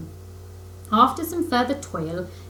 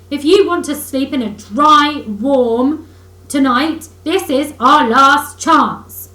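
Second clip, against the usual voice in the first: much louder, slowing angry orders; furious commands; 5 semitones higher, 10 dB louder than usual. A narrator's reading. Rat angrily ordering Mole to dig for shelter. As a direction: bark the orders with mounting fury. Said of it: "really angrily".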